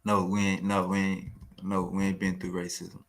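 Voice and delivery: a mild mock of the guest's denial; mocking quote. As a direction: parrot him with scorn. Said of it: "homie that would say something like this".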